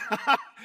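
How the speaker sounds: high, loud burst of laughter. Acted. Preacher mocking the obey-God mentality, loud laugh. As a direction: laugh out loud mid-rant. Laughs amid the mock warning.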